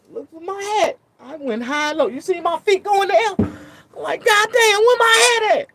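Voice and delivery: high, loud and frantic; tearing the room apart; loud high panicked telling; frantic search. Retold or imitated.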